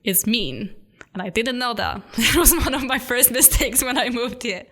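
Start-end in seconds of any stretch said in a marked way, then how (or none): 2.1-4.7 laughing. Real, not acted. Laughing at her own mistake.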